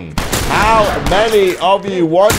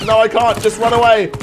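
ordinary delivery

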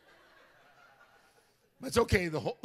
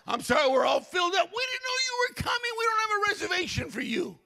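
much higher, loud, shouted clerk voice; mock hotel clerk; 14 semitones higher, 5 dB louder than usual. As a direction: play the flustered front desk clerk, pitched way up. Hotel clerk turning him away.

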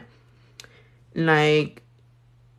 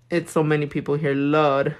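ordinary talk

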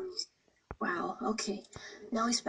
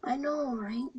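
ordinary talk

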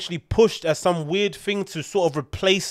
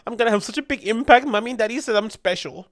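put-on higher-pitched, infantile little-girl voice; mock little girl; 7 semitones higher than usual. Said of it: "she sounds like a little girl".

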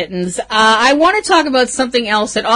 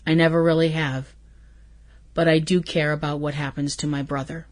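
quiet earnest quote; 5 semitones lower, 8 dB quieter, faster than usual. Retold or imitated. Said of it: "And he says".

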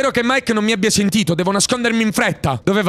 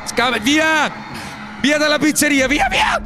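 urgent shout; 6 semitones higher than usual. Real, not acted. Fleeing the pizzeria, shouting urgently. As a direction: yell to get away. Urging escape in game.

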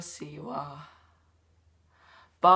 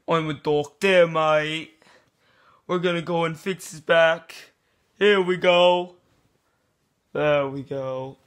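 louder lightly Aussie-tinged doctor voice; mock doctor; 5 dB louder than usual. Fake doctor assuring the vlogger, lightly Aussie and louder. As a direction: play a dodgy bloke doctor. Plays a doctor saying mate.

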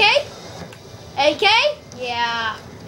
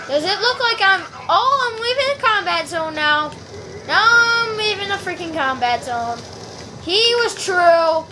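ordinary talk

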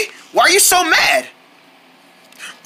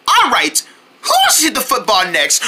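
normal talking voice

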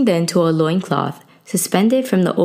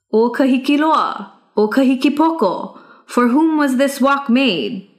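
higher, ringing call to the guards; young hero calling out; 7 semitones higher than usual. Acted. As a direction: call them out, bold and pointed. Youth calls to the guards.

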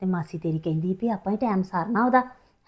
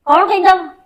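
indignant shout; 9 semitones higher, 13 dB louder than usual. Acted. Mother challenges son's accusation.

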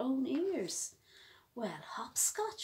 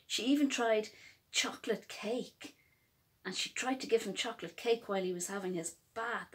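usual speaking voice

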